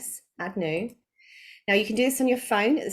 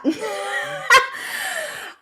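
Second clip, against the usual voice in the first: loud high laugh; 4 dB louder than usual. Real, not acted. Reacting to stiff sales script.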